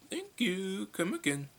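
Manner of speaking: put-on high Indian-accented shopkeeper voice, Apu-style; Apu impression. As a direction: chirp it like Apu behind the counter.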